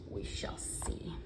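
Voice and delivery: soft unvoiced whisper. Real, not acted. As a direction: breathe it quietly. Whispered aside to viewers.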